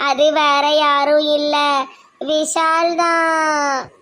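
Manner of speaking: slow build to a long-held final word; drawn-out, suspenseful announcement; dramatic reveal. Acted. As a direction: stretch out the big reveal. Dramatic reveal of secret helper.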